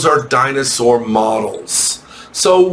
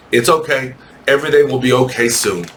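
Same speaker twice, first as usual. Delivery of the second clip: normal talking voice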